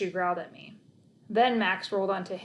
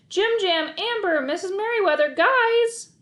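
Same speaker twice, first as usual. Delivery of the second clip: loud, high calling out names; running and yelling; crying out; 11 semitones higher, 7 dB louder than usual. Acted. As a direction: call after runaway dogs. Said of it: "I cried, running after them".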